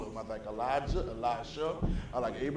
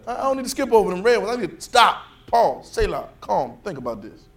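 steady, raised preaching voice, a bit calmer; sermon delivery; 6 semitones higher, 5 dB louder than usual. Acted. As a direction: keep preaching, pressing the point.

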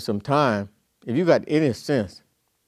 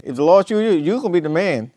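ordinary talk, nothing marked

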